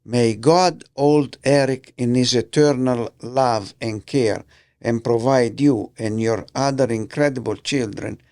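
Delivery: ordinary delivery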